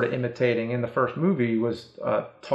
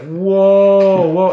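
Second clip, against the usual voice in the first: startled whoa; 4 semitones higher, 7 dB louder than usual. Retold or imitated. Reaction to the doll.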